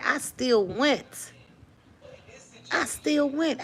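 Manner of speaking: high, building testimony cry; rising preacher fervor. Real, not acted. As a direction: lift it up with conviction.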